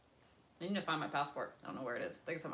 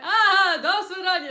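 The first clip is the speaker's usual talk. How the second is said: much higher, louder cheerful farewell; cheery mock reply; 16 dB louder than usual. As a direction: wave them off brightly. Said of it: "And they went".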